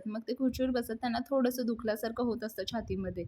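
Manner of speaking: ordinary delivery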